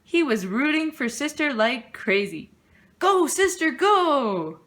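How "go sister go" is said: high excited cheer. Acted. Papa cheering Sister from the audience, excited shout. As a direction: cheer her on from the stands.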